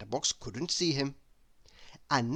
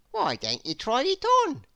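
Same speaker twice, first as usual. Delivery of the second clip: high, sly voice with a grin; grinning hatter; 11 semitones higher, 4 dB louder than usual. Acted. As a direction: tempt him with a grin. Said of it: "said Mister Bowler, grinning".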